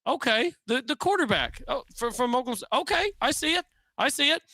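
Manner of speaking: high, puzzled, then catching on; surprised realization. Pretend. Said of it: "everybody around the country is gonna look around and say".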